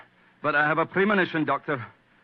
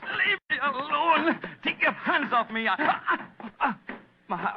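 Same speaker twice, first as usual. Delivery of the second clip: panicked struggle; 11 semitones higher than usual. Acted. Man collapses with heart attack.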